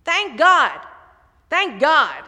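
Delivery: loud, high cry of thanks. Retold or imitated. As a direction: call it out loud to get the horse going. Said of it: "he says louder".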